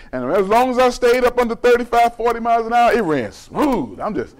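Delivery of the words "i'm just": normal talking voice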